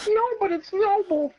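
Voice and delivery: high, whiny hero protest; mock noble hero. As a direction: defend your honour in a high put-on voice. Hero voice defending his swords.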